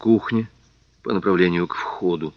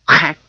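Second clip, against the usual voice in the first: sharp hack cry; 8 semitones higher, 7 dB louder than usual. A narrator's reading. Narrated blow, sharp cry.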